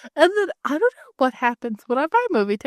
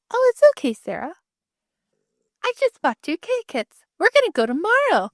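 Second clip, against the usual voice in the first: smug gloat; 8 semitones higher than usual. Retold or imitated. Gloating to Sarah's husband.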